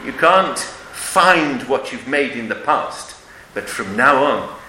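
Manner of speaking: normal talking voice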